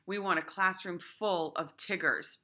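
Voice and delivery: normal talking voice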